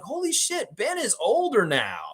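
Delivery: high-pitched mock astonishment; playful high-voiced amazement; amazed reaction. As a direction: squeal it in disbelief, retelling your shock. Retells shock at grown-up kid.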